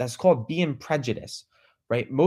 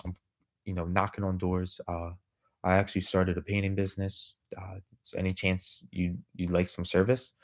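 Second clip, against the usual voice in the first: low, soft robotic monotone; hesitant robotic sales pitch, low and toneless; 7 semitones lower, 7 dB quieter than usual. Retold or imitated. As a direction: imitate a stiff, robotic young door-to-door salesman. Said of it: "was robotic like that".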